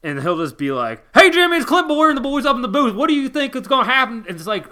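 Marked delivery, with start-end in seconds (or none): 1.1-4.2 loud, high-pitched, fast Southern good old boy mockery; mocking Southern twang, pitched way up and belted out; Clint Bowyer's good old boy accent. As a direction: holler it as an exaggerated Southern booth announcer. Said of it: "tries to put on his accent and his good old boy routine".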